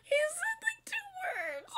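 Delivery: very high, tearful squeak; overwhelmed squeal. Real, not acted. Fan hearing singer's first words, overwhelmed high squeal. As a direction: squeak it out as if about to cry.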